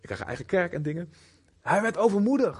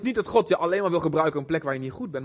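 ordinary talk

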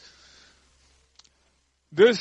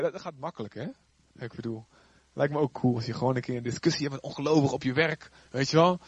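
ordinary delivery

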